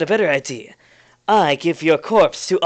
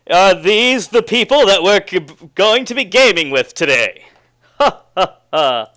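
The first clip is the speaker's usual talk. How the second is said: loud, higher, boastful NPC with a mocking laugh; cocky character voice; 4 semitones higher, 6 dB louder than usual. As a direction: puff out your chest and brag loudly.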